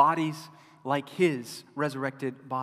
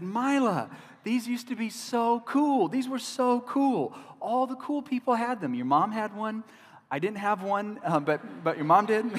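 excited dad; 8 semitones higher, 4 dB louder than usual. Retold or imitated. Dad gushing to his teen daughter about old iPods, excited and high. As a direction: gush about how cool they were.

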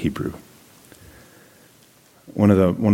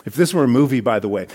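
usual speaking voice

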